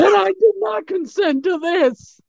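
squeaky, indignant mock victim; high mock victim voice. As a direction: play the outraged abductee, hammed up. Retelling abductee's words, mocking.

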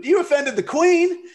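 high-pitched mock indignation; mock outrage. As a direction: squeal it in playful, hammed-up outrage. Mock outrage at jab against Europe.

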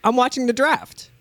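high-pitched imitation of an eager fan; acted excited quote. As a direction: put on an eager sports fan's reply. Acted quote of draft watcher.

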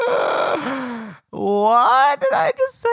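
high, slow, cracking up at her own slip; laughing in disbelief. Real, not acted. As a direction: squeal through the giggles at what you just said.

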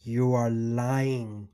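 slow down and lay it out: you are lying; firm and slow to her face. Retold or imitated. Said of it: "told her to her face".

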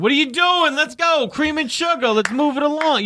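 hammed-up impatient local at the counter; impatient Boston customer. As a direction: hurry the whole coffee line, pitched high. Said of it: "somebody like".

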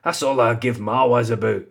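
put-on trying out a Scottish accent; put-on Scottish vowels, as in "aboot". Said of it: "is that Scottish?".

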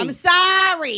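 loud, high, stretched-out sorry; mock apology shout. Co-host answering with apology to Boston, in a drawn-out mock shout. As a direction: drag the apology out in a yell.

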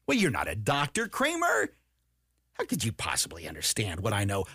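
squeaky Kramer then low sneer; mock condescending expert. Critic belittling Kramer, mocked with a put-on voice. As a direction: sneer like a smug credentialed expert. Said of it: "mocking expert's voice".

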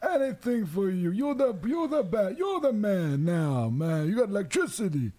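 put-on high, slow old man voice; grumpy old man. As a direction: play the cranky neighbor, drawn out. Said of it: "Thanks, Pete".

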